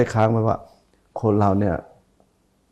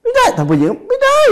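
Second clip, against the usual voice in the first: mocking whine; 4 dB louder than usual. Mocking unforgiving person.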